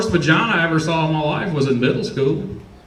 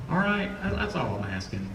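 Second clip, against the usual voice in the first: ordinary talk, nothing marked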